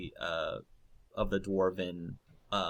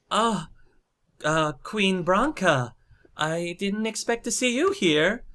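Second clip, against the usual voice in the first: high, louder hesitant voice; Dagna, taken aback; 11 semitones higher, 5 dB louder than usual. Acted. Dagna unexpectedly facing Queen Branka, high and flustered. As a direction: nervously greet royalty, pitched up.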